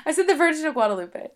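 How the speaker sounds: high, loud and quick through laughter; laughing English version. Real, not acted. Co-host demands a repeat in English, laughing high and loud. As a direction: say it in English, cracking up. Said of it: "Okay, say it in English".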